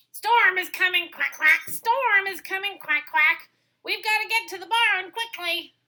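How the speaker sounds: loud, high quacking alarm; duck voice. Acted.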